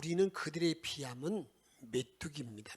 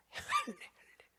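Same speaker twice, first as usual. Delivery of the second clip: whispered, then fast; hushed reveal of the word; slower than usual. Real, not acted. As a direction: breathe the word like a secret.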